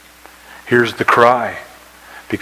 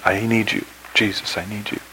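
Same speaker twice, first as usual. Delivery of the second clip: soft repeated prayer; an understated cry to Jesus; hushed plea; 7 dB quieter than usual. Acted. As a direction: pray it quietly, dropping away. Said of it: "that's one of my cries".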